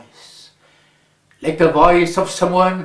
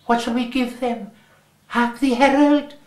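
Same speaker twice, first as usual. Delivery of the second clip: high, quick, eager question; a boy pitching the next carol, keen; lightly voiced eager boy; eager child asking; 7 semitones higher than usual. Boys choosing a carol to sing.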